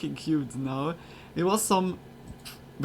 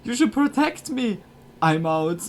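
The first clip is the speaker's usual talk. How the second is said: mock panicked plea; 6 semitones higher, 5 dB louder than usual.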